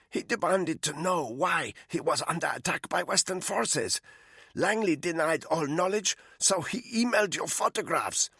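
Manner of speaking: a frightened captive pleading; captive woman's voice. Acted. Malinka explains the CIA file.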